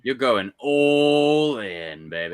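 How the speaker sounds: drawn-out loud cheer; hyped shout. Real, not acted. Cheering a friend on about his film, loud and drawn out. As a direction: yell it slowly to pump him up.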